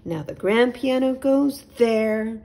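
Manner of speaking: a prim owl politely insisting all is fine; high, fluttery owl; fussy little owl. A narrator's reading.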